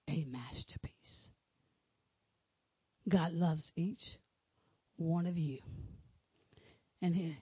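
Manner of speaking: hushed, tender closing words; intimate whispered sermon. Acted. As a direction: lean in and whisper the blessing. Quiet, low, whispered close.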